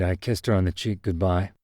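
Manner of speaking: plain reading voice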